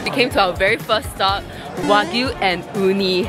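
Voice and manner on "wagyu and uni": usual speaking voice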